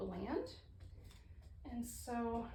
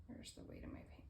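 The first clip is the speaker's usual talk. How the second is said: whisper; 13 dB quieter than usual. Real, not acted. Muttering to herself while painting.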